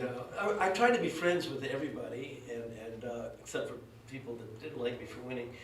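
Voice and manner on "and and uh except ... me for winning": normal talking voice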